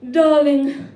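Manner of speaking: loud, projected motherly telephone voice; mum's phone voice. Acted. Mum warning daughter about her creepy ex-boyfriend, loud phone voice. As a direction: put on Mum's big phone voice. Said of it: "That's my mum's phone voice".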